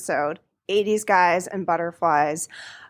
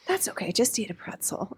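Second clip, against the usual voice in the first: hushed quote; 7 dB quieter than usual. Retold or imitated. Quoting her co-host.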